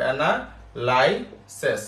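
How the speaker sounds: Analysis stretched very slowly, each syllable drawn out; slow dictation. Shown as a demonstration. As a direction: spell it out slowly so they can write. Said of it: "তাহলে এটা কি আসবে?". (Analysis খুব ধীরে টেনে টেনে বলা)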